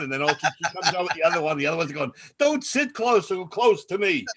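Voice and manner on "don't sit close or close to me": high, slow mock scolding; imitated fussy complaint; loud scolding. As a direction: warn someone off, drawn out and high. Retold woman scolding another.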